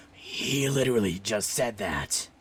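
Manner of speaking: slow, strange layered voice; multiple beings voice. Acted. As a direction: try many beings at once. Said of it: "I can't do that voice".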